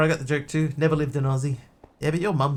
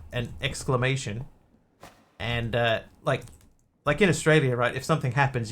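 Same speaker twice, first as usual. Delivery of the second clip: normal talking voice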